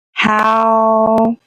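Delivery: ordinary talk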